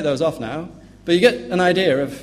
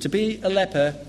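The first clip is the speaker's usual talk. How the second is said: usual speaking voice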